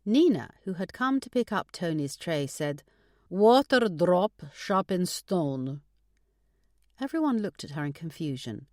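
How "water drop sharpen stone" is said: put-on Russian accent. A narrator's reading. Russian Nina's proverb.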